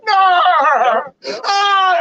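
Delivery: loud, high wail breaking into "ah"; bitter cry. Acted. Preacher acting out the cheated son, loud mock wailing. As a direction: overplay a devastated howl. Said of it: "cried with a great and exceeding bitter cry".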